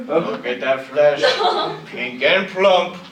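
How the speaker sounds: slow, loud, hammy singsong wolf with a laugh; musical wolf. Retold or imitated. Comedian voicing the wolf from Into the Woods, slow and hammy. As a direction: savor each word like a hungry stage wolf. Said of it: "a wolf who's into musicals".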